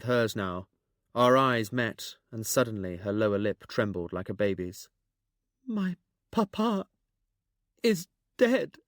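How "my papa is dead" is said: put-on high, slow, broken-up young girl; stammering. A narrator's reading. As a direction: stammer it out, halting on each word. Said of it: "she stammered out".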